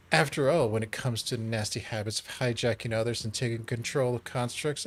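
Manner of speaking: normal talking voice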